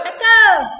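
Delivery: very loud, high shout; loud call. Shown as a demonstration. Co-teacher shouts back to test the echo, loud call. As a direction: yell it into the room.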